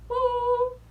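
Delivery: long, high-pitched woo; high cheering whoop. Pretend. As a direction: whoop for your star sign. Cheers for fellow Sagittarians.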